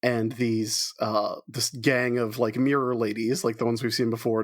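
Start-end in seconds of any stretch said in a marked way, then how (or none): none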